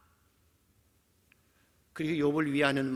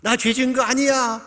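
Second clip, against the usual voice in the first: loud, high-pitched denial; protesting; 10 semitones higher, 10 dB louder than usual. Acted. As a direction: protest innocence at the top of the voice. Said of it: "라고 항변할 때는". (큰 소리로 억울한 듯 항변)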